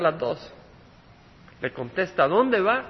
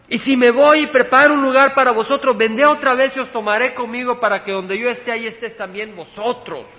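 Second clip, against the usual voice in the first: loud, raised preaching voice; forceful preaching; 5 semitones higher, 6 dB louder than usual. Acted. Preacher quoting Jesus to the congregation about the Father's house, forceful preaching. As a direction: drive the scripture home, loud and high.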